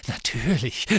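word tangled in tipsy giggling; angry drunken giggle. A narrator's reading. Trelawney scoffing at Luna's mention of Firenze, drunken giggle. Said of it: "mit einem zornigen, betrunkenen Kichern".

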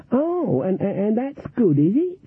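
put-on very high, stammering old lady; Granny fakes wonder at winning; mock-delighted granny. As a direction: gush like a naive beginner.

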